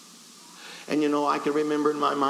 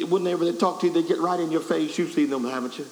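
ordinary delivery

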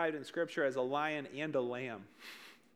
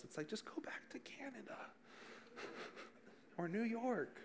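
whispered, higher joking jab; a whispered joke about bad drivers; mock whisper; 6 semitones higher, 10 dB quieter than usual. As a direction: hush a cheeky aside under your breath. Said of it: "Just kidding".

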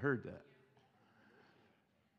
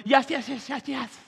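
eager yes; 9 semitones higher, 8 dB louder than usual. Retold or imitated. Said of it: "And Jesus is like".

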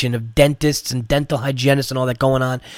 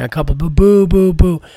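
mock booing, higher and louder; imitated crowd booing; 4 semitones higher, 4 dB louder than usual. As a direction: boo like a hostile crowd. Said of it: "the place starts booing".

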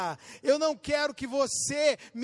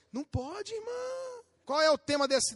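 usual speaking voice

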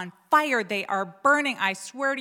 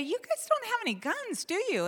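nervous hopeful question; 11 semitones higher than usual. Retold or imitated. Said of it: "And I ask the guy".

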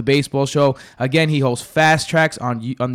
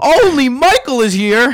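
excited; 9 semitones higher, 5 dB louder than usual. Acted. Host announces in hype voice.

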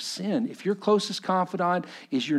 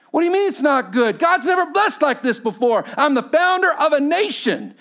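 louder, faster, higher Moses protesting; indignant protest; 10 dB louder than usual. Acted. Moses protesting Jethro's rebuke, loud indignant protest. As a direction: bristle and push back hard. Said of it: "Moses bowed".

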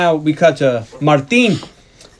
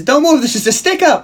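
high-pitched pretend hold-up order; laughing mock robber's yell; 10 semitones higher than usual. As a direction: imitate her yelling in a high voice. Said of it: "and she yells".

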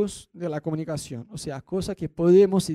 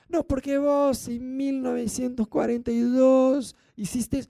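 mock resentful wife; 6 semitones higher, slower than usual. Resentful wife recalling old grievances.

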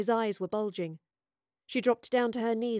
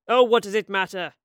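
loud, impatient interruption; irritated snap; 9 dB louder than usual. A narrator's reading. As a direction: cut in sharply, much louder. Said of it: "snapped Quintus".

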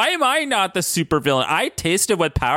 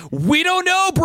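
mock scream; 13 semitones higher than usual. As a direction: shout it like a clueless teen. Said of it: "Quicksilver screams".

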